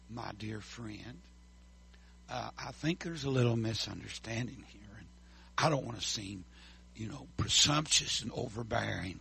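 soft, low, overly polite grumble; mock timid objection; mild polite complaint. Shown as a demonstration. Preacher demonstrating what indignation is not, softly.

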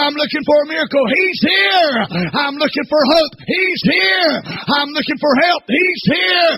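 high, loud shouted preaching; climactic preaching. Real, not acted. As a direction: build to a roaring climax.

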